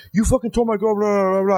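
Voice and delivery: put-on indignant boyfriend; mock angry accusation. Acted angry confrontation in story.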